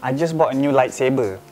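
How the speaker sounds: apologetic Malaysian English with lah; casual Manglish excuse; Manglish accent. Shown as a demonstration. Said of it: "When friends ask you for money".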